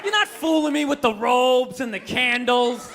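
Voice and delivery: ordinary talk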